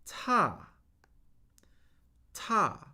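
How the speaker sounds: "ta" falling sharply from high; fourth tone. Shown as a demonstration. Teacher modelling tones for repeating, "ta" high then falling. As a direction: start "ta" high and drop it steadily. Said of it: "the fourth tone steadily falls".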